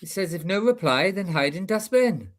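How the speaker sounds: a light Valleys Welsh lilt on the message; lightly done Welsh Valleys accent. Shown as a demonstration. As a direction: read the note in a gentle South Wales Valleys accent. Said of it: "you've just done a Valley's accent there".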